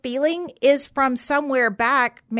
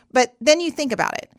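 usual speaking voice

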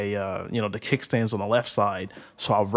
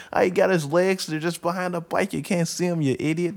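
uncle scolding; 8 semitones higher than usual. Retold or imitated. Uncle scolding the kid for a bad photo, mock annoyed. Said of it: "he's like".

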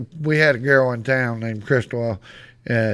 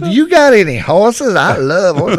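higher, louder imitation of an excited gal; gushing flirt; 6 semitones higher, 6 dB louder than usual. Gal flirting with the Texan, mock high-pitched excitement.

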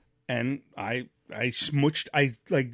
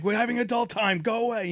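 put-on shooing the kids away; 6 semitones higher than usual.

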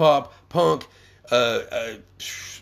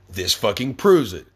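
ordinary delivery

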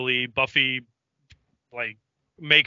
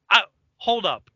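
higher, louder imitation of being thrown; mock bewilderment; 8 semitones higher, 5 dB louder than usual. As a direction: act out a stunned double take. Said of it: "the look on his face is like".

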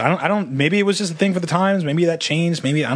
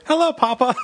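high-pitched imitation of a child; little kid; 11 semitones higher than usual. Unknown child greets returning sailor grandfather, high kid voice. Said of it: "this fucking kid with a briefcase".

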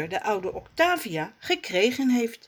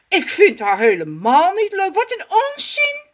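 high, loud angry shout; furious; 12 semitones higher, 8 dB louder, faster than usual. Acted. Raf explodes at Rommes, very high and loud. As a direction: explode at him. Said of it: "roept hij woedend".

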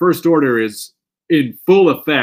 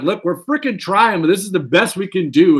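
higher, louder, imitated exasperation; mock exasperated plea; 6 semitones higher, 4 dB louder than usual. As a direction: plead as if doing your honest best. Voicing effects makers pleading their case.

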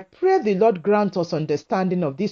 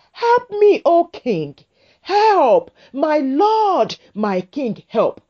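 desperate cry for help; 7 semitones higher, slower than usual. Acted. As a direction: cry out to the king like a starving woman begging. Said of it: "So she cried out".

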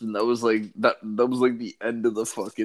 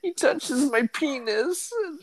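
fake crying. Prank caller pleading to the helpline, pretend crying. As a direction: wail it like a kid pretending to cry. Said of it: "pretending to cry".

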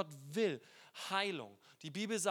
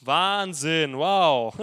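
mock sarcastic; 3 semitones lower, 13 dB louder, much slower than usual. Sarcastic Naaman after second dip.

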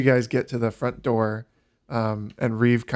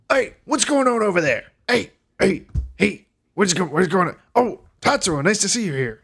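excited call; 9 semitones higher, 4 dB louder than usual. Acted. Dirk calls out cheerfully.

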